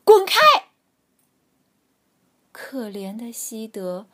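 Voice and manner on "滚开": high, loud angry yell; shouting. A narrator's reading. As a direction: yell him away. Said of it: "人们大喊着".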